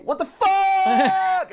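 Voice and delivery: long, high, drawn-out shout; mock falling yell. Friend imagining a climber losing his grip, mock falling yell. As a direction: yell it as you drop off the wall.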